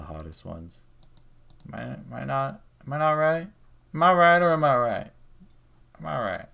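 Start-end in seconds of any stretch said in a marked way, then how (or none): 1.7-6.4 high-pitched, jokey insistence; pressing for agreement. Acted. Fishing for agreement on British accents, high playful insistence. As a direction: push it higher each time, peaking loud, then drop back.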